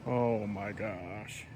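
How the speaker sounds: slow, hushed, awestruck; slow hushed awe. Real, not acted. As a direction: breathe it out in awe. Awed at storm clouds.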